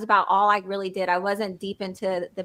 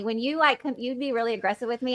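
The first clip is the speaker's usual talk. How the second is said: ordinary talk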